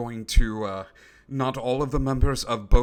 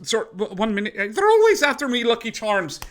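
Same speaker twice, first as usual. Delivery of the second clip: high, fast, squeaky cartoon leprechaun; a voice changer gone haywire into a leprechaun; pitched-up mock Irish, rushed and louder; glitchy leprechaun voice; 10 semitones higher, 5 dB louder than usual. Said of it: "He goes".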